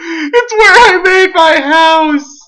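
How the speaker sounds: high, tearful wail of joy; teary-eyed. Real, not acted. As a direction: let it well up in a high voice. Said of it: "I'm getting all teary-eyed".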